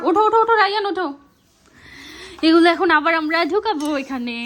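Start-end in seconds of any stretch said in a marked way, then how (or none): 0.1-1.1 urgent high call to get up; alarm shout. Real, not acted. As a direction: shout at him to get up fast. Ryan lying on the papers. (জরুরি চিৎকার)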